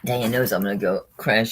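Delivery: usual speaking voice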